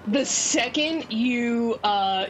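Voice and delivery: normal talking voice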